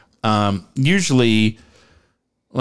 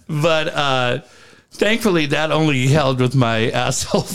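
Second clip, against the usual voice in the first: ordinary talk, nothing marked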